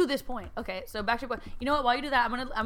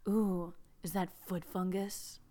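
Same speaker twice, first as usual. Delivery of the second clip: mock sensual; 3 semitones lower, 6 dB quieter than usual. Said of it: "gonna be extra sensual".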